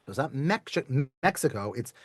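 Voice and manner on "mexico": usual speaking voice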